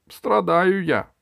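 high, loud, drawn-out suffering; hammed-up royal self-pity; plaintive moan. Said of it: "И король жалобно застонал". (жалобный стон)

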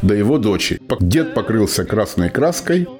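ordinary talk

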